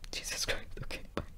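whispered disbelief then quick goodbye; stunned whisper. Retold or imitated. Hanging up on his girlfriend, stunned whisper. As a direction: breathe it out in shock.

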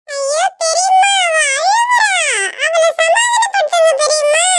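put-on high-pitched little-kid plea; anxious, high childish cry; alarmed child. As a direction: plead for help in a high child voice. Child alarmed that the girl cries.

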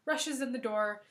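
ordinary delivery

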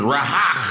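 RAHAN with a stressed second syllable and throaty ending; mock-mangle RAHAN as "ra-HAKH"; favorite mangled name. Teacher botching a pupil's name, mangled RAHAN. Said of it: "They go my favorite".

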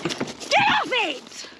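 high, long-drawn angry yell; furious order. Real, not acted. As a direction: stretch it out as a piercing order. Fending hands off the car.

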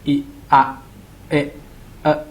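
short, abrupt "อิ อะ เอะ เอะ", each on its own; short vowel sounds. Shown as a demonstration. Tutor showing light syllables, "อิ อะ เอะ เอะ" said short. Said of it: "ออกเสียงแบบ".

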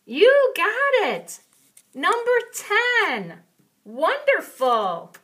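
put-on big, slow, high cheer for a child; excited praise. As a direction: cheer the kid on like a proud teacher. Praising child after answer.

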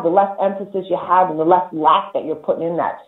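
usual speaking voice

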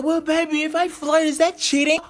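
a hint of a coy girlfriend asking; mock girlfriend voice. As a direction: mimic the ex's sugary question. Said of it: "She was like".

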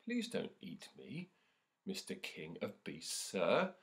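normal talking voice

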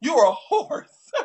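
slow, laughing insult. Real, not acted. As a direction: laugh through the words as you mock him. Laughing while insulting caller.

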